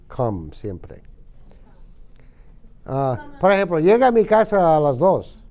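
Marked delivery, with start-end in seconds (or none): 0.1-1.0 slow down and lean on come; slow emphatic. Shown as a demonstration. Teacher stresses that come is always used, slowly. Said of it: "pero en inglés es".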